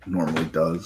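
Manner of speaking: talking through hiccups; hiccup-broken speech. Real, not acted. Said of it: "I have the hiccups".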